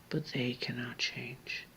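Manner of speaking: slow, hushed, grim whisper. Real, not acted. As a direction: let it drop to a whisper. Grim confession to viewer.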